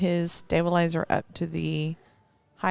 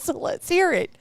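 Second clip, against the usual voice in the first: a hint of a pushy cashier, pitched higher; 9 semitones higher than usual. Young cashier dares the tired mom to say it, higher teasing voice. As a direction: toss off the dare like a teasing stranger.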